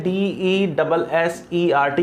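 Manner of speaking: D E double S E R T spelled letter by letter; teacher spelling for the exam; spelled out slowly. Shown as a demonstration. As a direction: spell डीईडबलएसईआरटी slowly, one letter at a time. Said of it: "एक word होता है".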